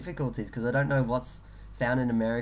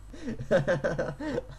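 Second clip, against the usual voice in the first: laughing; 5 semitones higher than usual. Real, not acted. Laughing after accent.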